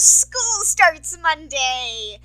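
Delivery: slow, stretched-out words; drawn-out announcement. Acted. Student telling listeners why uploads will slip, drawn out. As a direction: drag each word out. Announces school with mock cheer.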